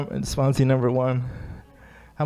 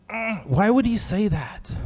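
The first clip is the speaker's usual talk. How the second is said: incredulous; 7 semitones higher, slower than usual. Retold or imitated. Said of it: "I'm like".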